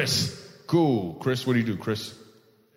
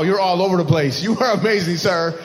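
usual speaking voice

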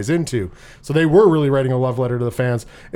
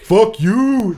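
louder outburst; 7 semitones higher, 5 dB louder than usual. Retold or imitated. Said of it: "You were like".

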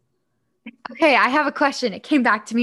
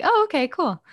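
high, breezy go-along; easygoing agreement; 7 semitones higher than usual. Retold or imitated. Yoga teacher accepting any odd claim, breezy. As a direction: shrug it off cheerfully. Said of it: "I'd be like".

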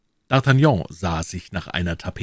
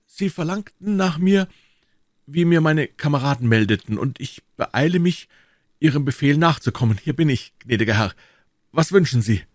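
higher, steady soldier voice; higher, dignified wounded Athos; wounded musketeer; 9 semitones higher, 4 dB louder than usual. Acted. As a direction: hide the pain, stay composed.

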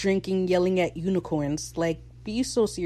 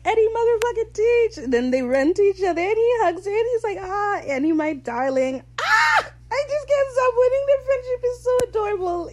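very high, loud fangirl squealing; excited squeal; 6 dB louder than usual. Real, not acted. Fan retelling a beloved reunion, squealing with excitement. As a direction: shriek with delight, pitch soaring.

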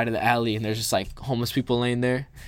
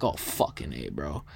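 startled low mutter; 10 semitones lower, 9 dB quieter than usual. Retold or imitated. Kids bump into them running.

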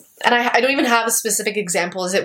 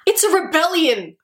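higher, whiny naive protest; a mock naive rich kid, pitched up; mock naive Sejanus; 7 semitones higher than usual. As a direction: play him as clueless and defensive. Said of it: "Sejanus is like".

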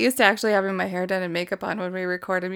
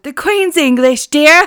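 put-on posh British accent; 6 semitones higher, 6 dB louder, slower than usual. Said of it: "they said".

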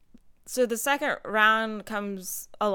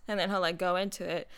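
normal talking voice

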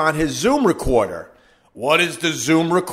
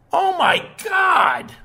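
exasperated shout; 13 semitones higher, slower than usual. Real, not acted. Exasperated at DC's doubt.

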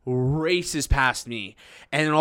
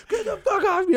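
high-pitched imitation of angry man; mock struggling protest. Bear-hugged brawler to the server, mock angry squeal. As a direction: imitate someone fighting to get free.

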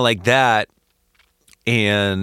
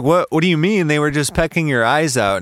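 higher-pitched, startled disbelief; incredulous, raised-pitch acted protest; baffled Dorothy; 6 semitones higher than usual. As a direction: question him in astonishment, pitched up.

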